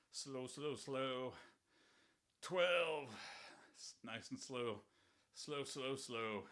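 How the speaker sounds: strained, quiet counting mid-rep; pushing through the last reps; straining under effort. Real, not acted. Straining through a heavy set.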